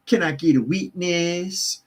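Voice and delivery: high, slow Southern revival call; mock Southern drawl. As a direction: draw it out high in a put-on Southern accent. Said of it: "dropping into a drawl".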